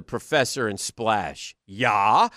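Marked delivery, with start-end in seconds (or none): 1.7-2.3 hammed-up fake Swedish. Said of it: "best fake Swedish ever, John Candy".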